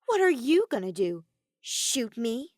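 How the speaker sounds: loud scoffing dare. A narrator's reading. Kokichi dares Kaito to shoot, scoffing. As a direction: toss the dare off with contempt. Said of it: "Kokichi scoffed".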